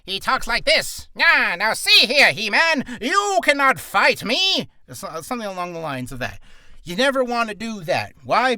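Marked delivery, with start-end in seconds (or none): 0.1-4.7 high, loud cartoon villain; hammed-up, drawn-out villain cry; Skeletor. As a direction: stretch it out slowly and taunt like a villain. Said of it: "around the voice of Skeletor".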